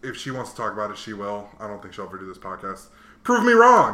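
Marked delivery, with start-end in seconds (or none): 3.2-3.9 loud, high challenge; playful dare. Real, not acted. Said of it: "daring girlfriend to join podcast".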